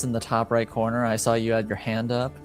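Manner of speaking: ordinary delivery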